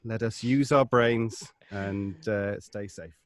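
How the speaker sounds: usual speaking voice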